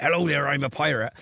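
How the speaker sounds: light mock Cornish pirate voice; tossed-off West Country pirate; a hint of Cornish pirate; pirate accent. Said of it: "he just happened to have a Cornish accent".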